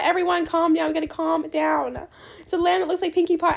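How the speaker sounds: ordinary talk, nothing marked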